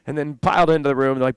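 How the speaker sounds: usual speaking voice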